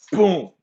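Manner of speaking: short, loud, high boom; sudden boom. Retold or imitated. Showing the man's hand going to the gun, sudden boom. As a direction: punch out one sudden sound effect.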